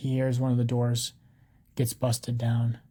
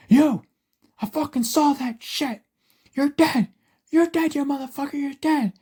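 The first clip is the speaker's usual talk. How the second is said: high, loud enraged shouting; furious threat; 14 semitones higher, 6 dB louder than usual. A narrator's reading. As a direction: scream threats at the cop across the cells. Prisoner threatens cop who hit him.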